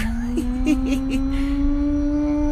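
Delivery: high put-on giggle; giggling. Tigus giggling at his bulky reflection. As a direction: giggle out loud at himself. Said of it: "Tigus giggled out loud".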